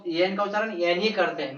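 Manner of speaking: normal talking voice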